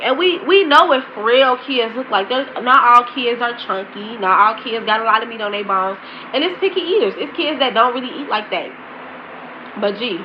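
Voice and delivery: ordinary delivery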